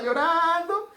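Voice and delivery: drawn-out, rising pretend wail; mock wailing. Preacher imitating a grieving relative to the congregation, mock wailing. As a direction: stretch it into a half-sung mock lament. Acts out the mourner's weeping.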